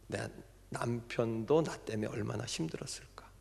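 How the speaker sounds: hushed, remorseful inner thought; whispered realization. Retold or imitated. Deaconess realizing her husband's pain, whispered. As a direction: whisper it as a private regret.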